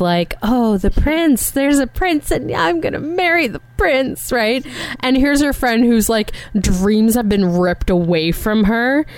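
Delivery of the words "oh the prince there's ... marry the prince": lightly gushing, higher-pitched swoon; happy place. Acted. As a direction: mimic her dreamy delight over a prince. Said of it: "in her happy place".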